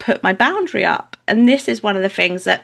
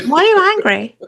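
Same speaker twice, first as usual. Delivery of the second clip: high, louder pointed question; insistent acted challenge; 11 semitones higher, 5 dB louder than usual. Mother repeating it to her angry family, insistent challenge. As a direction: press the question in a much higher voice.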